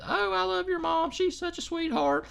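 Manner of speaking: squeaky adoring fan voice; mock sweet fan. A chat fan praising Durkee's mom, high and sugary.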